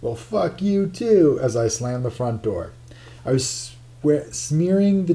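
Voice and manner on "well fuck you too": yelled back. Acted. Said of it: "I could just hear Keith yell".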